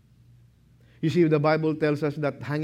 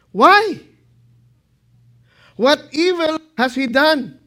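high, loud demanding why; Pilate's outcry; 11 semitones higher, 9 dB louder than usual. Acted. Pilate protesting to the crowd, loud and high. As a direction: cry out in protest. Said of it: "And he said".

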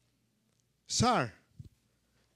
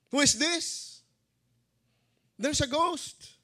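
high, alarmed questioning cry; frightened disciples; 12 semitones higher than usual. Acted. Preacher voices disciples puzzled by the figure, frightened. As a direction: act startled, pitched right up high. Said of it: "they cried out in fear".

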